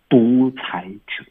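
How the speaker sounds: slow, spooky stage whisper; drawn-out, hushed warning; ominous whisper. Acted. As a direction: whisper it slowly to frighten them. Showing how scary dictators are.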